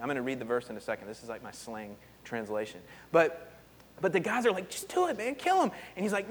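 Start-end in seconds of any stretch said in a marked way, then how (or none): 4.7-5.7 high, egging-on voice; pushy urging. Retold or imitated. David's men pushing him to kill, high and eager. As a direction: goad him to do it.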